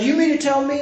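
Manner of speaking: high, indignant retort; mock being mad and defensive; imitated angry defensiveness. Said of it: "he basically got real defensive. And he got mad".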